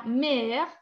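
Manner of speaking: MÈRE in one syllable, ending on the R; silent final E. Shown as a demonstration. Teacher showing students the mute E, MÈRE ending on R. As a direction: say MÈRE as "mehr", last E left mute. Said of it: "es muda".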